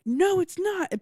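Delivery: high-pitched retold denial; mock protest. Bennett denying Rhett's accusation, in high mock protest. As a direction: imitate an insistent objection.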